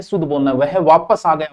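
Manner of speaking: ordinary talk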